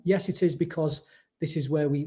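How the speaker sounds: ordinary delivery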